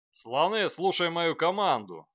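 loud gruff military bark; barking command. A narrator's reading. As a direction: bark orders at the ranks. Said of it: "гаркнул командир". (командир гаркает на строй, громкий рык)

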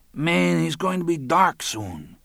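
high, slow character voice; reluctant phone character. A narrator's reading. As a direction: object in a high, drawn-out voice. Lionel objects on the phone.

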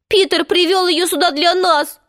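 high-pitched despairing realization. A narrator's reading. Boy realizing she was brought for them, despairing. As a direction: lament it, pitched well up. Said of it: "И он в отчаянии шмякнулся на землю".

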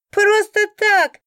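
high, loud jeering echo; drawn-out, high derisive repeat; chuckling mockery. A narrator's reading. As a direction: parrot it back in a mocking squeal. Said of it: "хохотнул кто-то".

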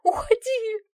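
very high, loud cry; screamed. A narrator's reading. Wife drives husband from the door, screaming. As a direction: shriek to drive someone away. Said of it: "закричала Лиза".

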